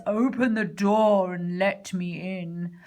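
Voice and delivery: a hint of a sly growling wolf. A narrator's reading. Wolf demands the pigs open the door, sly growl. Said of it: "growled a sly voice".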